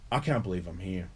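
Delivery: ordinary talk, nothing marked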